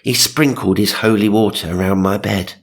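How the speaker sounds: ordinary talk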